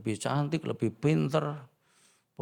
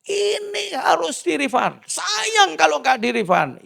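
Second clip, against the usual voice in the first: much higher, slow, drawn-out wife voice; higher nagging wife, slow and insistent; 9 dB louder, slower than usual. Retold or imitated. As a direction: mimic the wife pleading to refund.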